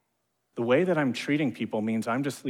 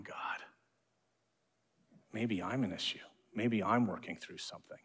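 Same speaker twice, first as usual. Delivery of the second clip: hushed confession; 3 semitones lower, 10 dB quieter than usual. Retold or imitated.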